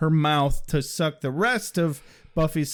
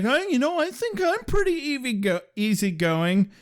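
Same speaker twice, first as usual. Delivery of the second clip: put-on girly Buffy voice; 7 semitones higher than usual.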